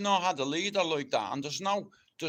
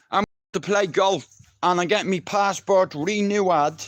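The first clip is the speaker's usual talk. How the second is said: louder, higher boozy cheer; rowdy cheering; 5 semitones higher, 9 dB louder than usual. Real, not acted. Back with the boys, loud cheering. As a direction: cheer the lads like a terrace chant.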